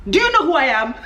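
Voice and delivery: mock self-important question. Imitating people who ask this question, mock self-important. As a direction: put on a hint of someone pulling rank. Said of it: "Have you ever heard this question?".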